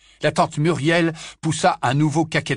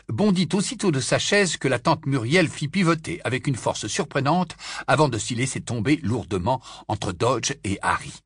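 usual speaking voice

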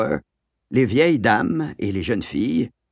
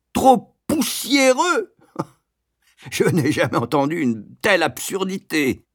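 incredulous shout; 8 semitones higher than usual. A narrator's reading. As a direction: roar it as utter nonsense, then a scoffing laugh. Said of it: "hurla-t-il". (cri outré et incrédule)

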